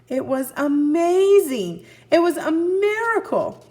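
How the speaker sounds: mild storyteller awe; awed. A narrator's reading. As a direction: marvel at it out loud. Narrating the wonder of flight.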